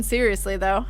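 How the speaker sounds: usual speaking voice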